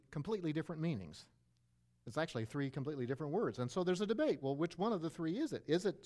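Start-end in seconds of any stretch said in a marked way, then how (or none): none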